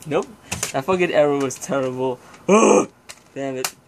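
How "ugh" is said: disgusted groan. Real, not acted. Disgust at recalling the era.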